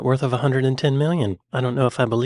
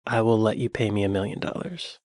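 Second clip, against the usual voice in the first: ordinary talk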